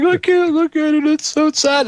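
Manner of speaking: imitating a weepy wife in high falsetto; high-pitched, exaggerated tearful whine; mock crying. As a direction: put on an overwhelmed, tearful voice. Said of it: "my wife starts crying when she sees it".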